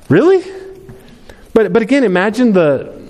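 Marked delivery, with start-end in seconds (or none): 0.1-0.4 a sharp high, loud disbelieving really; incredulous really. Retold or imitated. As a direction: voice shoots up in disbelief. Mary tells Joseph it's from God.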